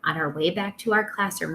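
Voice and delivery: usual speaking voice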